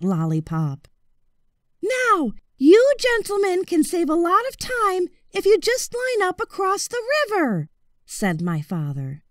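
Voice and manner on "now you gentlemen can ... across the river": put-on higher, polite boyish voice; high, courteous young voice; boy's high voice.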